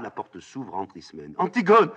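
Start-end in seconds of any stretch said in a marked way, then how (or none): 1.4-1.9 high, loud cry of a name; urgent calling out. Acted. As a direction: call out to your sister in alarm. Ismène entre et appelle sa sœur. (appel crié, voix aiguë)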